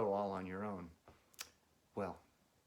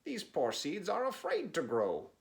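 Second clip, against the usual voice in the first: higher, a bit louder Frog scolding; lightly voiced Frog; 6 semitones higher, 4 dB louder than usual. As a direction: Frog gently chiding, raised pitch. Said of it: "said Frog".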